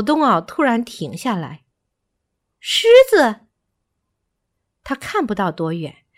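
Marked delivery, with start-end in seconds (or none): 2.6-3.5 very high, loud yelp of alarm; startled monkey shriek; alarmed cry. A narrator's reading. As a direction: cry out a sudden danger warning. Monkey suddenly spots a lion.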